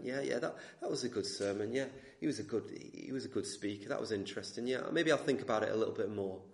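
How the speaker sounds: low, soft, offhand acknowledgement; casual indifference. Acted. Preacher imitates a listener leaving after the sermon, noncommittal. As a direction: shrug it off politely, half-interested. Acting out a noncommittal churchgoer's reply.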